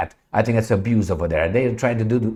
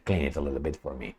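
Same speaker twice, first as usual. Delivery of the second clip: much lower and softer taunt; mocking mutter; 9 semitones lower, 13 dB quieter than usual. Real, not acted. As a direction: drop it to a sly mutter. Mocking aside.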